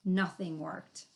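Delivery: plain reading voice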